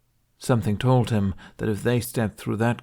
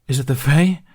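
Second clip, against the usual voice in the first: alarmed; 5 semitones higher, 9 dB louder than usual. Acted. Explosions, fleeing through woods.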